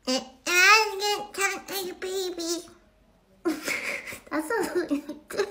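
put-on high, drawn-out baby talk; whiny little baby denial, played for laughs. Shown as a demonstration. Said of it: "this would be like talking like a baby".

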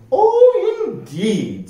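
very high, prim opening; un-piratelike rascal. A narrator's reading. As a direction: coo it properly, starting high and dropping. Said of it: "said the rascal".

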